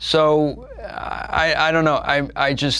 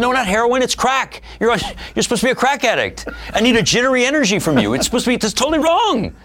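high, fast, loud impatient scolding; mock angry director; 14 semitones higher, 4 dB louder than usual. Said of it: "She said".

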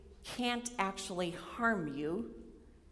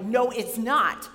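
louder, slow, firm retort; mad denial; 7 dB louder, slower than usual. Retold or imitated. Student angrily contradicting her advisor, loud firm no. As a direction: push back hard at him. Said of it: "which made me really mad".

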